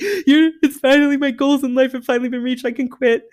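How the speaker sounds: overjoyed. Pretend. Imagined ecstatic TikTok post.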